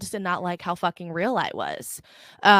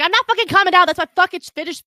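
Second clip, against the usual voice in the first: loud, high-pitched outburst; yelled angry comeback; heated refusal; 9 semitones higher, 7 dB louder, faster than usual. Retold or imitated. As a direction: refuse furiously to calm down. Acted refusal to calm down.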